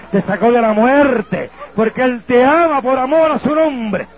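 high, driving revival preaching; preaching at full force. Acted. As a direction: rouse the congregation, chanting and pushing. Predicación de liberación a pleno. (predicación a todo pulmón)

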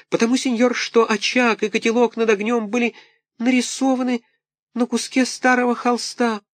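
put-on very high, wailing small-boy voice; pitiful little puppet. A narrator's reading. Buratino pleads with Karabas, high wailing. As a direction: wail like a poor orphan puppet.

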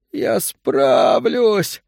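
very slow, high desperate cry; drawn-out plea. Acted. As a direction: stretch out a broken promise. Old man's last plea.

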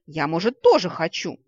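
loud, emphatic jealous whine; jealous protest at full voice; jealous cry. A narrator's reading. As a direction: demand your share, loudly. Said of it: "ревниво вскрикнула Ладиина".

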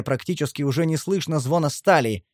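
usual speaking voice

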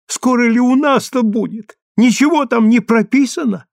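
high-pitched impatient questioning; eager interruption. A narrator's reading. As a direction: break in eagerly, pitched well up. Old wife interrupts the letter impatiently.

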